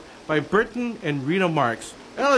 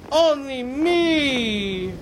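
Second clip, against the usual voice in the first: mock whimpering; 7 semitones higher, much slower than usual. Mock sob ending.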